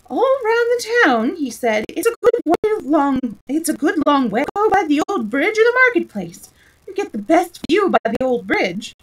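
high, loud, nervous voice; nervous quivering king. A narrator's reading. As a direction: babble it with nerves to stop a fight. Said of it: "his voice quivered".

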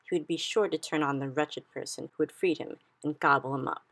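usual speaking voice

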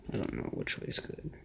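frustrated unvoiced whisper. Real, not acted. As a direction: whisper it, torn and annoyed. Frustrated unsure muttering.

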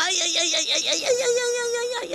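high wailing ouch ouch ouch; cries of pain. Acted. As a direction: wail it out high like a hurt bug. Louse burns its behind.

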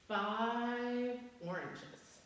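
very slow, drawn-out reveal. Acted. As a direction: stretch out the big reveal. Big reveal.

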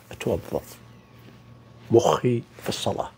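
a breathy whispered aside. Acted. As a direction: drop to a whisper, almost to yourself. (همس خافت)

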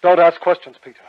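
loud, cutting order; sharp command. Acted. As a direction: shut him down at once. Stranger cuts Peter off.

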